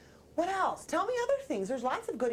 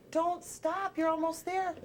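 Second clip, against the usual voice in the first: encouraging voice; 3 semitones higher, slower than usual. Acted. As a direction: cheer on someone who is flagging. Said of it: "Buzzy heard a voice say".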